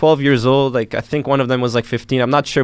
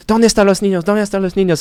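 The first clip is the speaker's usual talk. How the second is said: worried mother; 8 semitones higher, faster than usual. Retold or imitated. Frantic mother asks for kids.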